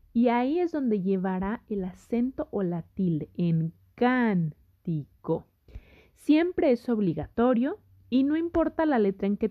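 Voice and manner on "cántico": "cántico" very slowly, split can-ti-co; syllable by syllable. Shown as a demonstration. Teacher showing where the tilde goes, syllable by syllable. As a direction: draw out "cántico" with a gap between can, ti and co. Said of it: "que la separes en sílabas".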